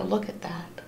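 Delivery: hushed whisper to the class. Real, not acted. Teacher shows the class the sad picture, whispering. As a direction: breathe it out, barely voiced.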